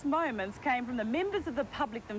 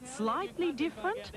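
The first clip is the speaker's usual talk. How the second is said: wry; 7 semitones higher than usual. A narrator's reading. Wry echo of interviewee.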